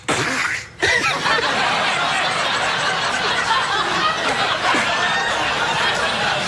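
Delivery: long breathy high laughter. Real, not acted. Rickles story punchline, long high laughter. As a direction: let the laugh run on.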